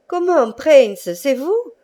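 high, slow astonished question; amazed to see his friend; surprised recognition. Acted. Battered Tartarin recognising the Prince, surprised question. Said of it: "fit le bon Tartarin".

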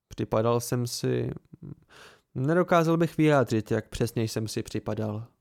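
plain reading voice

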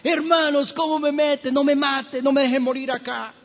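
high pleading cry; mock desperate plea from a pit; José crying out. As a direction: beg for your life from the pit. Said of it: "José gritaba".

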